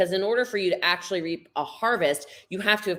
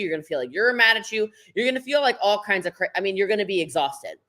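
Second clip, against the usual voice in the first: ordinary delivery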